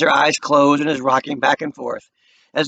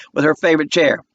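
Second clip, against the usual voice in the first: plain reading voice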